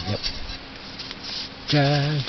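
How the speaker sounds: ordinary talk, nothing marked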